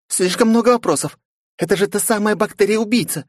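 put-on high, reproachful girl voice; indignant young woman. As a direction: scold the boss in a high voice. Narrator voices Flow.

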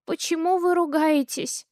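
put-on high little-girl voice, scared; a child alarmed by shouting; frightened child. A narrator's reading. Daughter asks why they quarrel, scared.

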